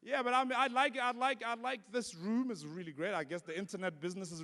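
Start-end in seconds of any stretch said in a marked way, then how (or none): none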